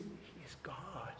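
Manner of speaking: soft, slow reverent "He is God"; hushed awe. Real, not acted. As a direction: let it land in a hush. Awed declaration.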